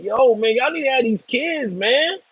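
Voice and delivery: lightly voiced dictator scolding his people; upset Kim Jong-un. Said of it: "he's upset. He's like".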